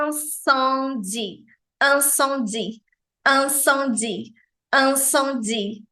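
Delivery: incendie devagar, nasais bem marcadas, lábios em movimento; repetição lenta. Shown as a demonstration. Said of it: "faça aí esses movimentos labiais". (incendie lento e nasal)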